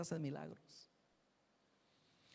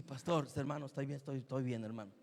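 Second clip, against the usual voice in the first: dazed reply; 6 semitones lower than usual. Retold or imitated. Dazed fallen man answers.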